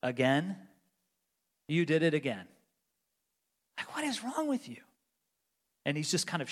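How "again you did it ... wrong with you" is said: a disappointed, reproachful voice in the head, rising on the last question; mock a weary, disapproving judge; imagined scolding God. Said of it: "is God going, just going like".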